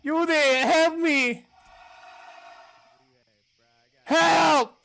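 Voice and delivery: high, loud desperate calls; shouting for help. Real, not acted. Hunted player yelling to teammates for help. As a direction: call out for rescue at full voice.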